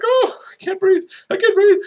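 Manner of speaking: very high, loud, breathless-with-laughter squeal; hammed-up helpless laughter. Friend cracking up at Jerry's joke, hammed-up hysterics. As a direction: act out laughing too hard to breathe.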